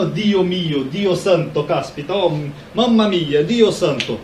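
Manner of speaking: mock Italian exclamations with stretched stressed vowels; imitating an Italian. Teacher acting out an Italian invoking God, mock Italian accent. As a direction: play up an Italian accent, drawing out "mamma mia". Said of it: "O italiano fala".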